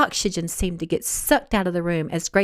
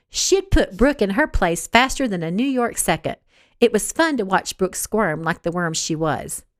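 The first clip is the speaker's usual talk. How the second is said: ordinary delivery, nothing marked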